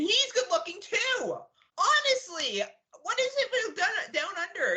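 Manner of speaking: high, incredulous whine; exasperated disbelief. Real, not acted. Co-host griping about good-looking Australians, high exasperated outburst. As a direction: complain in a high, stretched-out pitch.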